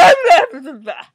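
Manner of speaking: loud wordless roar of frustration; angry, drawn-out yell; frustrated growling scream. Real, not acted. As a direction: scream out the exasperation. Frustrated noise after angry retort.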